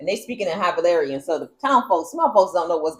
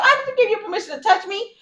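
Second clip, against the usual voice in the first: mock dragon; faster than usual. Dragon voiced angrily.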